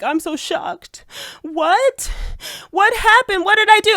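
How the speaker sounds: high, slow fake gasping surprise; mock shocked innocence. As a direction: play the wide-eyed innocent. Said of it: "I didn't respond being like".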